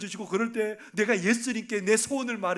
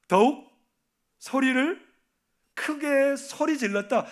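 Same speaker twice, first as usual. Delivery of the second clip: 더욱 punched loud, 소리를 higher, 크게 소리 질렀다 high and slow; emphatic preaching; 5 semitones higher, 5 dB louder, slower than usual. Shown as a demonstration. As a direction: hammer out each word of the climax. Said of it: "preacher stresses each word". (한 단어씩 힘주어 외치는 설교)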